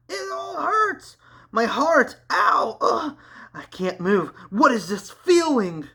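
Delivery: high, crying cries of pain. Acted. The stricken villain crying out in pain, high and hurt. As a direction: gasp it out as if stabbed. Struck in the golden heart.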